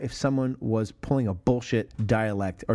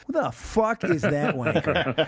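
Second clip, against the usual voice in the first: slow, hammed-up English accent with a laugh in it; mock English accent; 3 semitones higher, slower than usual. Host imagining English listeners, slow mock English accent. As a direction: play an offended English listener, slowly. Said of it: "I hope they don't go".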